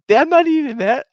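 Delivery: put-on a kid flatly refusing his dinner; kid's loud refusal. Son refusing the poo-poo platter, loud whiny protest.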